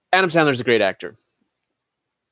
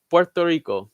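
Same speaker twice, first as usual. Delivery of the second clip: a hint of Spanish accent, slightly higher; light Spanish accent; 4 semitones higher than usual. Shown as a demonstration. Host trying the pronunciation once more, light Spanish accent. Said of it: "How do you pronounce Puerto Rico in Spanish dialect".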